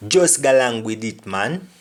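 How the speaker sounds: patois line Just galang with it, man; Jamaican patois. Shown as a demonstration. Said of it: "Meaning, just go along with it".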